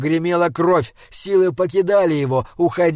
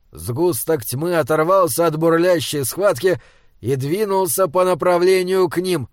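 ordinary delivery, nothing marked

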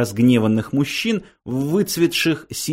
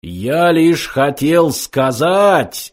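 higher, very slow, big voice; thunderous roar; 4 semitones higher, 4 dB louder, much slower than usual. A narrator's reading. Computer cutting across the philosophers, roaring. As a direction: roar each word stretched out. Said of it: "оглушительно взревел компьютер".

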